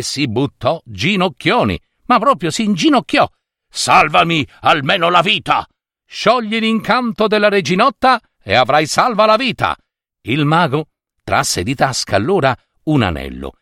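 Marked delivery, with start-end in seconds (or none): 3.7-5.7 urgent, higher-pitched plea; pleading. A narrator's reading. Wizard on his knees before the youth, pleading. Wizard kneels begging for life. 6.1-9.8 high, insistent demand; commanding. A narrator's reading. Young hero bargains with the kneeling wizard, commanding. Youth dictates terms to wizard.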